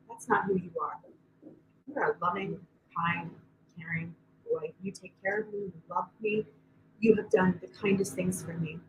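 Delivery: put-on soft, calm words to a child; tender reassurance. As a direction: gently remind him who he is. Mother calmly speaking to son.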